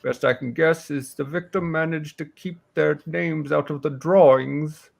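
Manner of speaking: put-on self-assured old warrior briefing hunters on a killer; aging warrior, scornful and certain; old warrior woman. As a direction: lay out the case as a seasoned warrior. Game master voices Hilin.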